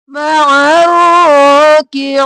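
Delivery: मअर राकिईन with throat ain, drawn out; correct ain. Shown as a demonstration. Teacher models the right मअर राकिईन for students. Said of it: "आइन गले के दरमियान से".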